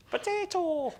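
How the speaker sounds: very high, loud Irish squawk; hammed-up Irish yelp, pitched right up; mock Irish accent. Shown as a demonstration. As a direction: shout it in a cartoon Irish accent.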